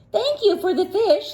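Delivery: high, sweet seal thanks; high cartoon seal voice; grateful seals. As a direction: thank him like little seals. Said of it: "said the seals".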